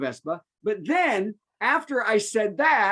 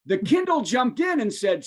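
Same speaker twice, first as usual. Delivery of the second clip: normal talking voice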